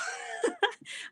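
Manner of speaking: squeaky unvoiced burst of laughter; high breathless laugh. Real, not acted. Mom cracking up at her own story, airy squeal. Laughing after mock cry.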